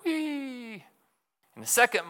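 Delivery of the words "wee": high squeaky mouse cry; a tiny mouse sliding down, wee; high mouse squeal of delight. Acted.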